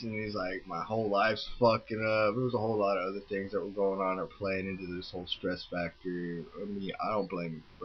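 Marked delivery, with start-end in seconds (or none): none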